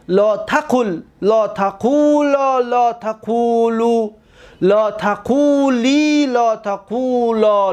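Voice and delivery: slow recitation with long held vowels; paradigm recitation. Shown as a demonstration. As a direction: stretch each long vowel evenly. Teacher recites the verb paradigm.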